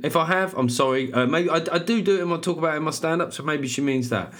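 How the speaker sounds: usual speaking voice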